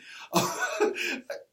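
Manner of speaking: a high, breathy burst of laughter after the boast; high airy laugh. Real, not acted. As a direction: crack up at yourself, high and airy. Laughs at his own boast.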